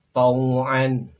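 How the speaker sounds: "Tau'an" loud with a glottal catch before -an; model Arabic reading. Shown as a demonstration. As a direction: sound out "Tau'an". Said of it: "Berikutnya silakan". ("Tau'an" dengan hamzah jelas)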